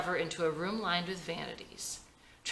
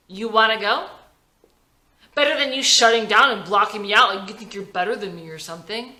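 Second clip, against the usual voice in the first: angry challenge; 4 semitones higher, 9 dB louder than usual. A narrator's reading. Cleo squares up to Howie.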